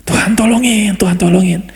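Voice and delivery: high, urgent plea, repeated; anxious pleading. Pretend. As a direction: beg in panic. Fearful crying prayer acted. (doa panik yang memohon)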